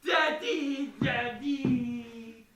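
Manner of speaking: put-on childish wail for Daddy. Joey wails for his Daddy, childish crying wail. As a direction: cry it out like a kid.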